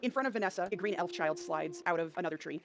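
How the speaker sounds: normal talking voice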